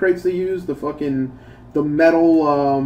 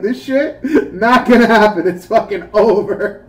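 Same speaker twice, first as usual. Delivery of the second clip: laughing friend quote; 3 semitones higher, 4 dB louder than usual. Retold or imitated. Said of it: "He's like".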